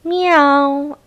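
high squeaky little meow; kitten meow. Acted. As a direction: mew like a tiny hungry kitten. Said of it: "said a hungry little kitten".

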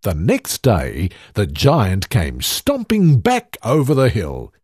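ordinary delivery, nothing marked

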